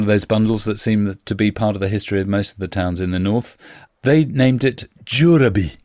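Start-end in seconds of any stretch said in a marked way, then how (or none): 4.0-5.8 slow, hint of a Viking accent on Djuraby; a touch of Viking accent, slow. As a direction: lightly put on Old Norse accent, drawn out. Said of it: "that's my best Viking accent".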